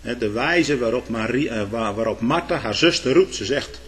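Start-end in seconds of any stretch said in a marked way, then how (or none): none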